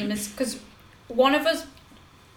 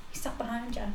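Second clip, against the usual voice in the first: discreet, hushed; 11 dB quieter than usual. Retold or imitated. Secretly warning a friend on the bus, hushed. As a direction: slip it out softly.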